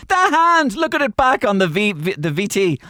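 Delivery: high, loud mimicked yelling; hammed-up hysterical insistence, starting very high; hysterical friends. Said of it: "they were laughing their head off".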